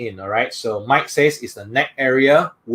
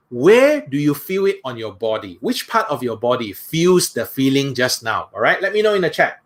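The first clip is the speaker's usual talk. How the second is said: ordinary delivery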